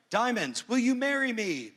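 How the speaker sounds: lightly mocking proposal voice. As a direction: play a giddy suitor popping the question. Mock proposal.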